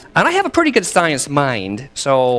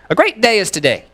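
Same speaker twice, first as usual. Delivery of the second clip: higher, louder, ringing proclamation; exuberant declaration; 9 semitones higher, 5 dB louder than usual. Retold or imitated. Speaker quoting partner's favourite declaration to the congregation, exuberantly. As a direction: declare it with rising gusto. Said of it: "my partner James often declares".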